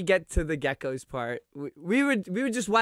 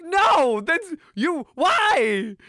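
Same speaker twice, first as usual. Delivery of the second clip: high, loud sputtering disbelief; flustered protest; 12 semitones higher, 5 dB louder, much slower than usual. Retold or imitated. Teens sputtering at the Russian dude, high and loud. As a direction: stumble in outrage. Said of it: "We're like".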